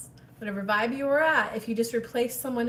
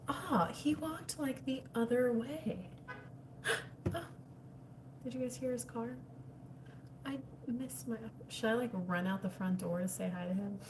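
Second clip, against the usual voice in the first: much softer, hushed; hushed aside; 10 dB quieter than usual. Real, not acted. Watching the neighbor outside, hushed excitement. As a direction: keep it quiet as he passes.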